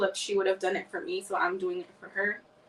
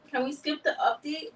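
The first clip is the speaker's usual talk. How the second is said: usual speaking voice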